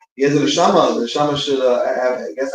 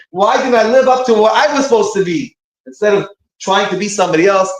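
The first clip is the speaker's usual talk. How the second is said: higher-pitched, pained self-questioning; anguished retelling; 6 semitones higher, 3 dB louder than usual. Retold or imitated. Rabbi recounts Zusha crying over not being himself, anguished. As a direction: imitate a tearful self-reproach, pitch raised. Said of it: "that's what he was crying".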